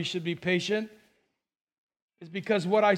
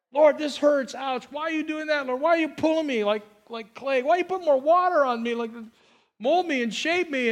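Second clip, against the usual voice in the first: higher, pleading whine at God; whiny complaint to God; 4 semitones higher than usual. Retold or imitated. Preacher mimics a believer whining at God, pleading. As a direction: complain to God like a hurt child.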